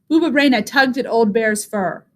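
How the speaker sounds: plain reading voice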